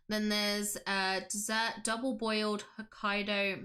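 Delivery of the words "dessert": ordinary delivery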